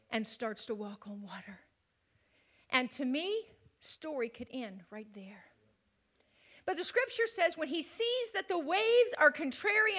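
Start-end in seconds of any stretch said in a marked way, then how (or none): 3.8-5.4 soft whisper; hushed aside to the congregation. Acted. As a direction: lean in and whisper it.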